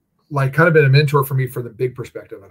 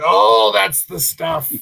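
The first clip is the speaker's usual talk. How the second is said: slow, groaning oh of pleasure, pitched up and louder; satisfied groan; 6 semitones higher, 7 dB louder, much slower than usual. Retold or imitated. Mentor under heavy pressure, groaning with satisfaction. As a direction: stretch it out like a groan of relief. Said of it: "a groan of, like, satisfaction".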